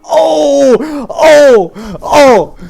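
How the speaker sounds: loud, high whoops of oh; elated shouting. Real, not acted. Player reacting to the lucky draw, elated shouting. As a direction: burst out in three big cries.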